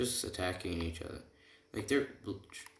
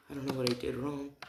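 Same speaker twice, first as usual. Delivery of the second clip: quick, higher-pitched mock bewilderment; confused; 6 semitones higher, faster than usual. Confused hero answers his stunned classmates, mock confused. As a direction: rattle it off high, baffled and innocent. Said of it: "'Cause he's all confused".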